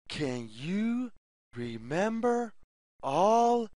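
ordinary delivery, nothing marked

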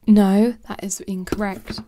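loud, abrupt correction of yourself; emphatic self-correction. Real, not acted. Catches her own mistake, corrects herself.

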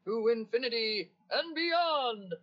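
big, ringing hero's battle cry; heroic catchphrase impression. Shown as a demonstration. Assistant doing a requested impression, rousing heroic cry. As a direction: proclaim it, drawing out the last word. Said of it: "Do another impression".

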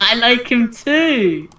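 high, loud, drawn-out cheer; gleeful slow shout; excited exclamation. Real, not acted. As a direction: blurt it out high and loud, stretching the last word. Excited outburst replying about Steve.

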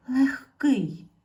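emphatic teacher "Легкий"; loud model word. Shown as a demonstration. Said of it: "Знову".

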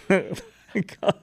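normal talking voice

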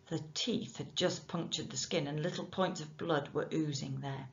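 ordinary delivery

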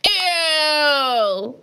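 disgusted ew. Real, not acted. Reacting to popcorn chocolate, disgusted ew. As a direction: recoil in disgust.

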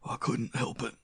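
strained, airless excuse; gasping. A narrator's reading. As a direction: gasp it with failing breath. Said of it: "gasped the dying man".

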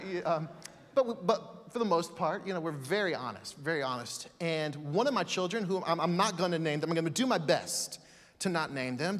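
ordinary talk

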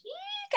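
tiny high-pitched eek of fright; mock squeak. Preacher imagining texting someone, squeaks in mock fright. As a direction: squeak like the idea scares you.

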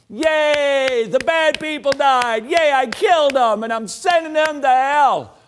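high, loud, cheering parody of a vengeful God; mock gleeful God. Preacher mocking the wrong picture of God for the congregation, giddy high cheer. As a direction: ham up a giddy god cheering over the damned.

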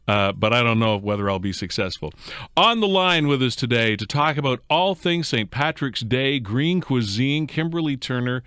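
normal talking voice

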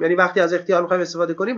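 usual speaking voice

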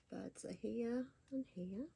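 ordinary delivery, nothing marked

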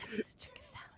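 breathy secret whisper. Real, not acted. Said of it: "Why we whispering?".

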